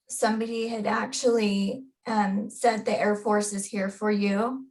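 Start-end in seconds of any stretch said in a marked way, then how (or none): none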